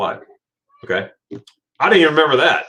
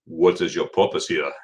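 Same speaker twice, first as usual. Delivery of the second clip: low, stern German official; deep-pitched, serious officer impression; serious customs officer; 7 semitones lower, 3 dB quieter than usual. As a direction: imitate an unsmiling border officer questioning a traveler. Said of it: "he was serious".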